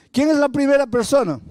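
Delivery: high, ringing preacher's question; altar call. Retold or imitated. Pastor asking who will come first, high altar call.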